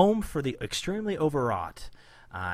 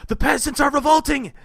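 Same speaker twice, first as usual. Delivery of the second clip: Napoleon shriek; 12 semitones higher, 8 dB louder than usual. Retold or imitated. Voicing Napoleon guy.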